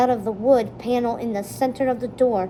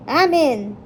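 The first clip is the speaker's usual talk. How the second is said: short, keen Cockney reply, a touch louder; Cockney accent; 4 dB louder than usual. A narrator's reading. Michael joining the adventure, in lively Cockney. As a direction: sign up in a cheery Cockney accent. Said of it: "he said in a Cockney accent".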